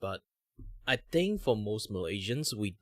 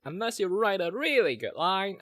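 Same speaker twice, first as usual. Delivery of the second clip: put-on old man voice; 11 semitones higher, 7 dB louder than usual. Shown as a demonstration. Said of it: "old man voice".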